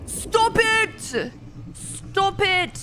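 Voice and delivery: high-pitched, alarmed cries; panicked yelp. Real, not acted. Player begging the enemy to stop, high panicked yelps.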